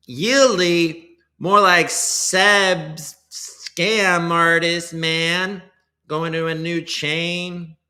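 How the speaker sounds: loud, high, slow sneering jab; mock roast. Streamer roasting a token name for chat, loud and mocking. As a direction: dunk on the coin like a heckler.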